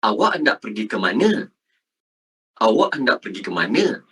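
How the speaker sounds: low, careful textbook question, rising at the end; formal Malay speech. Shown as a demonstration. Teacher models the question for scoring A, formal Malay. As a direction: say every word in full, formally. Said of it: "you have to speak like this".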